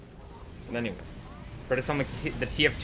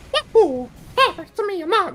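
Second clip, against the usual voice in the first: bad falsetto game-hero voice; Mario; 7 dB louder than usual. Streamer failing at an impression, high Mario voice. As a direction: squeal it very high, badly imitating him. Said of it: "That sounds nothing like Mario".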